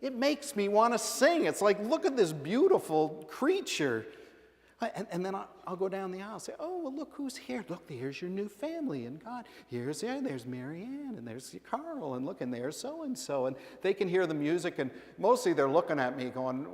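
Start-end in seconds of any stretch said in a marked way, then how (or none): 1.8-4.0 lilting, higher-pitched wonder; half-sing it to a baby in your arms; admiring singsong, pitched up; melodic baby talk. Retold or imitated. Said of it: "melodic kind of voice". 6.6-13.5 soft singsong introductions; quiet, lilting voice, showing a newborn around; melodic baby talk. Retold or imitated. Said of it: "talking to the baby with a melodic kind of voice".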